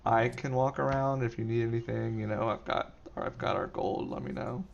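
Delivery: soft and careful, nothing condescending; gentle, not condescending. Retold or imitated. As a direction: keep it quiet and kind, not talking down. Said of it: "I didn't wanna do it in, like, a condescending manner".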